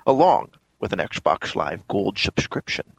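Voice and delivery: slurred Scottish film-star impression; Sean Connery. Co-host reading the news post on request, Connery impression. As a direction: read it out as a passable Scottish screen legend. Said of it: "a passable Sean Connery for you".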